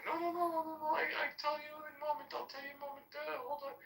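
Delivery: high, soft, hurried mock of the worker; flustered worker. Mimicking the restaurant worker who stalls him, high and soft.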